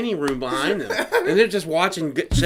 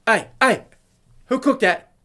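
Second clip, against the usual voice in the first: high, loud mock summons; imitated chef's challenge; 7 semitones higher, 4 dB louder than usual. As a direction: demand the culprit like an angry chef. Imitating chef confronting cook.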